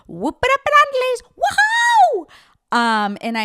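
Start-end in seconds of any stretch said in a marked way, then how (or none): 0.1-2.3 very high, loud, fast party whoop; shrieking party catchphrase; Vicki Gunvalson. Retold or imitated. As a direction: belt it like a reality star cheering. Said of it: "I think about Vicki Gunvalson".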